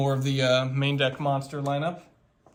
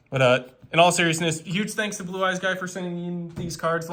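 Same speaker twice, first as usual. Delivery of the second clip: ordinary talk, nothing marked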